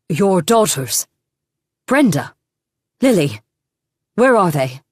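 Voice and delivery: loud, desperate shouting; frantic calling. A narrator's reading. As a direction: call out for missing daughters. Frantic father calls for daughters.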